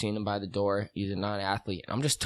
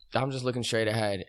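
usual speaking voice

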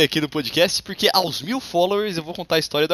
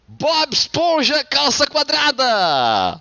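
high, slow announcer build-up; announcer intro; 10 semitones higher, much slower than usual. Acted. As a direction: stretch out the guest's name. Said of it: "nosso convidado especial". (apresentação de convidado, bem esticada)

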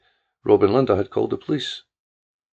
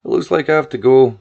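louder, back on the phone; 3 semitones higher, 7 dB louder than usual. Acted. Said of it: "her voice coming back clearer".

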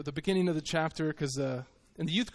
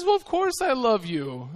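husband; 6 semitones higher, 3 dB louder than usual. Retold or imitated. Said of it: "And he says".

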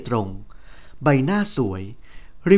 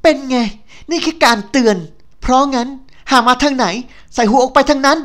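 high, loud mocking snap; a rival yelling at the injured heroine to get out; loud snarling threat; 10 semitones higher, 7 dB louder than usual. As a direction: taunt her to cover the guilt. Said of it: "ตะคอกเสียงดังอย่างกลบเกลื่อนว่า".

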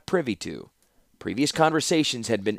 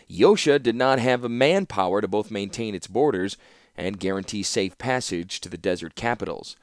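ordinary delivery

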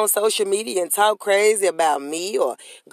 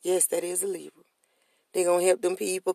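normal talking voice

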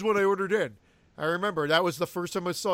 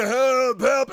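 louder, hammed-up call for help; mock plea for help; 4 semitones higher, 7 dB louder than usual. Retelling the injured man's plea, mock cry for help. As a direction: play a wounded victim calling out.

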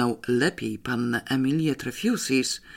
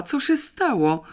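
high, worried question; fiancée rushing in, high and alarmed; 10 semitones higher than usual. A narrator's reading. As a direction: ask what happened in alarm. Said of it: "wykrzyknęła".

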